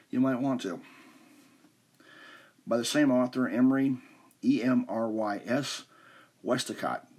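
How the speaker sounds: usual speaking voice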